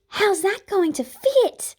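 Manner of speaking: high, slow, breathy gasp. A narrator's reading. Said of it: "The breathy gasp".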